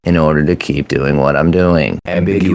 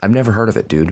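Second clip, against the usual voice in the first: ordinary delivery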